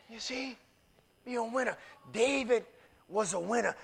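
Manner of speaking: ordinary talk, nothing marked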